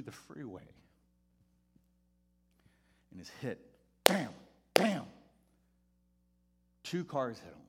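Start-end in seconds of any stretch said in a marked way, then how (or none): none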